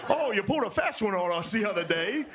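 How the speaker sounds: high, laughing dainty voice; prissy genteel soldier. Retold or imitated. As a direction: play it overly polite and high. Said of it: "that's how they talk to each other".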